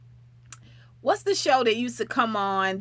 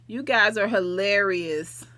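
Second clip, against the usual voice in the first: ordinary talk, nothing marked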